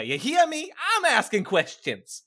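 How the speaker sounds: high, loud challenge, then slow; forceful demand. Acted. Player interrogating Waluigi, forceful demand. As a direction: press it home loudly, drawing out the second sentence.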